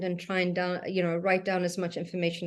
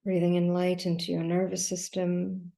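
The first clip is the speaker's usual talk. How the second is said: guided meditation. Acted. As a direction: guide them gently through each breath, leaving long silences. Leads a breathing meditation.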